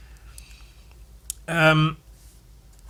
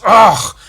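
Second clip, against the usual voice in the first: loud, higher disgusted groan; mock exasperated "ugh"; 7 semitones higher, 12 dB louder than usual. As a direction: imitate a loud huff of frustration. Retold exasperated groan before storming off.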